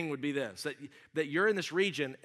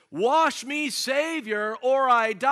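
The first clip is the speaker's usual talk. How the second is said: high, loud desperate plea; crying out; 9 semitones higher, 11 dB louder, much slower than usual. Acted. Hymn writer begs the Savior to wash him, crying out. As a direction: cry it out to heaven at full force. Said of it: "the writer cries out and says".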